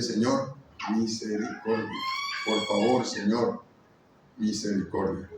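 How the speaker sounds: soft, low, pleading for mercy; retelling a tearful cry for mercy; tearful plea. Retold or imitated. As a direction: murmur it like a broken prayer. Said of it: "le lloré al Señor".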